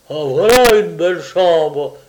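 loud bellowing chief; yelling. Acted. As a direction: roar it out at full voice. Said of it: "hurla le chef".

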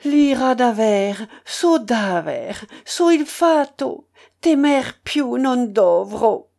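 loud, high, declaimed like an opera recitative; operatic echo. A narrator's reading. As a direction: ring out each phrase grandly, slowing at the end. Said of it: "et je répétais après lui".

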